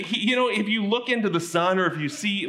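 usual speaking voice

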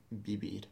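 vivir said softly, with B for each V. Shown as a demonstration. Teacher modelling a sound between B and V, soft B. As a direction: let the V's in vivir become soft B's. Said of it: "It's quite a soft B".

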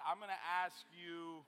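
ordinary talk

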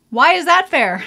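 loud, high mock-indignant cry; mock outrage. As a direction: protest the unfairness, loudly and high. Mock complaint about sister's smooth skin.

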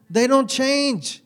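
they DON'T change, drawn out high and loud; emphatic slow stress. Real, not acted. Preacher pressing his point to the congregation, slow and emphatic. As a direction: lean hard on don't to drive it home.

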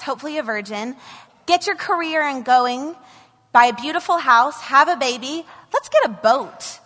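sarcastic list building up to a high, loud sneer at heaven; mocking sarcasm. Mocking list of the comfortable life.

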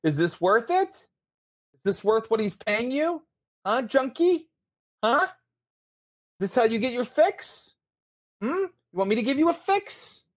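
high, mocking sing-song menace; taunting gunman. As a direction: needle the junkie while aiming the gun. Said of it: "points it right at Craig and goes".

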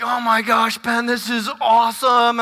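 ordinary delivery

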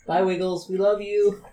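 ordinary talk

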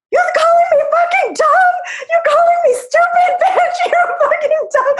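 high, loud enraged yelling; furious shouting. Retold or imitated. Brother screaming insults at the bully, high furious shout. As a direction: yell it like a livid big brother.

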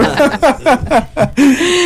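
loud high-pitched laugh. Real, not acted. Host laughs at guest's phrase.

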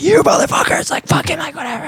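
high-pitched retold freak-out; going crazy. Retelling own outburst on the stairwell, going crazy. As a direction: squeal it like someone losing it. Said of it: "I'm just going crazy".

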